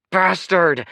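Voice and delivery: high, loud furious snarl. A narrator's reading. Kaya snarls at the man who groped her, furious and loud. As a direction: spit the insult with real rage. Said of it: "she snarled".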